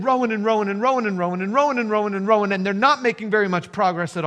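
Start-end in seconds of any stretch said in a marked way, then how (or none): 0.0-2.5 tireless rowing rush. Real, not acted. Preacher piles up the rowing.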